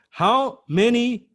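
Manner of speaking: HOW MANY stretched slow and high; emphatic. Real, not acted. Rhetorical question stressed.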